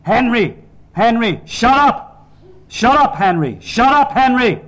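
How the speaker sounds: high, full-volume bellowing; enraged father screaming for silence; furious roar. A narrator's reading. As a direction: roar it right into the face. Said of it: "roared into my purple face".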